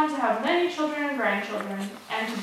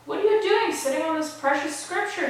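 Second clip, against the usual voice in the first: raised, higher voice of mild outrage; narrated shout; 6 semitones higher, 6 dB louder than usual. A narrator's reading. Father to daughter sitting on the sutra, mild narrated shout. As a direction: scold in a read-aloud shout, not full force. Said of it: "he shouted".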